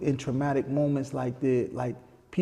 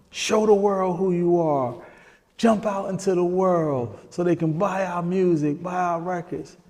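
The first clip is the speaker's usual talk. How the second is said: retold urging; 7 semitones higher than usual. Group urges a withdrawn bandmate into the world, retold pleading push. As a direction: urge him on, pitched up. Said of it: "we are trying to tell him".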